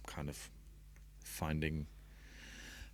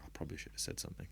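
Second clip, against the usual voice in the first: quiet, rushed mutter; 8 dB quieter, much faster than usual. Retold or imitated. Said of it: "you hang up and go".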